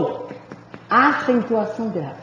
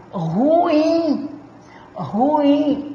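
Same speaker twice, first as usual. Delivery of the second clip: "ruim" very slow, in two syllables, leaning on "im"; stress on final syllable; 4 semitones higher than usual. Shown as a demonstration. Teacher showing students an oxytone, drawn-out stress on the "im" of "ruim". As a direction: stretch "ruim" out as ru-IM. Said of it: "Sílaba tônica é a última".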